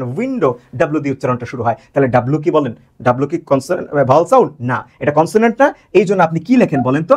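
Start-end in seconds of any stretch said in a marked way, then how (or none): none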